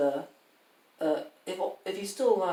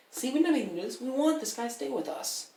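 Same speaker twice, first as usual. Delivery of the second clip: mock protest; 7 semitones higher than usual. Voicing Baldur's Gate's protest.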